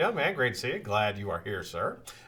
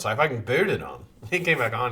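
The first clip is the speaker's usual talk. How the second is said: ordinary talk, nothing marked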